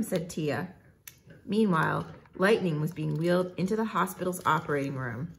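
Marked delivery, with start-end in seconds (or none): none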